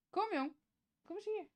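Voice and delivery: high, sweet calling in Dutch; pet talk. Real, not acted. Owner calls the dog to come, high coaxing. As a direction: coax the dog over, high and soft.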